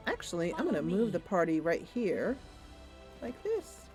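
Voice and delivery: normal talking voice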